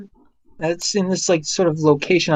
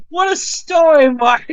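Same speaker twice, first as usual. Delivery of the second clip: laughing Tommy quote; 10 semitones higher, slower than usual. Retold or imitated. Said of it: "Tommy just sits there and goes".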